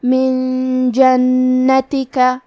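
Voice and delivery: من with a hidden nasal نون before جنتی, doubled نون held two beats; very slow chant, nasal ikhfa on من; slow recited demo. Shown as a demonstration. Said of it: "غنہ کے ساتھ ادا کریں گے".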